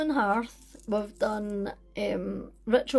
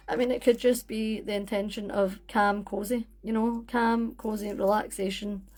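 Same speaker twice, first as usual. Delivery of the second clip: ordinary delivery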